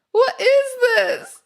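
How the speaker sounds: high, loud disbelief; shocked. Real, not acted. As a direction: shriek it in disbelief.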